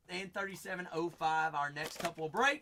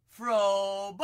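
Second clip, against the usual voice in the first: excited call-out; 4 semitones higher, 10 dB louder than usual. Real, not acted. Calling out a pulled name.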